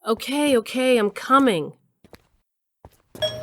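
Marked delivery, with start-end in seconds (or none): 0.0-1.7 high, exasperated call to the door; irritated calling out. Acted. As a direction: call out that you're on your way. Roused, shouts toward the door.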